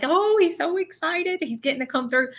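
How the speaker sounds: high-pitched eagerness, settling by the end; excited. Retold or imitated. Medium telling guest how he's coming through, excited. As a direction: lift the pitch to relay his excitement. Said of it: "that's how he's coming through".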